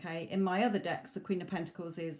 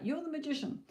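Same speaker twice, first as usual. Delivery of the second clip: higher, airy voice of spirit; spirit's voice; 8 semitones higher, faster than usual. Retold or imitated. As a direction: voice it as a spirit messenger. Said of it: "Spirit is like".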